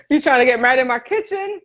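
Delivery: amused laughing question. Real, not acted. Reading a comment about marrying in her kitchen, laughing.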